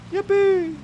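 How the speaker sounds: cartoonish celebratory squeal; gleeful yippee. Real, not acted. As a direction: throw the pitch way up in delight. Celebrates getting a new car.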